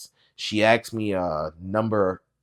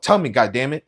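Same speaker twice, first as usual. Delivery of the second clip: angry; 5 semitones higher, 8 dB louder than usual. Acted. Frantic mother on phone.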